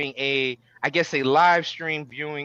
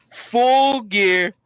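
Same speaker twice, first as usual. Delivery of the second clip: hype shout; 3 semitones higher, 5 dB louder, much slower than usual. Acted. Hyping the teams.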